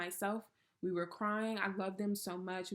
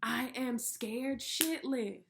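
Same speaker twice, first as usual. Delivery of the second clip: scared prayer; 4 semitones higher, much slower than usual. Retold or imitated. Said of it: "I'm like, God".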